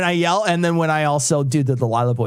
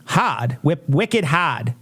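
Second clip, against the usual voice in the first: slow "wicked hard", Boston style, "hahd"; drawn-out R-less Boston "wicked hard"; Boston accent; much slower than usual. Shown as a demonstration. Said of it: "That's how they say it".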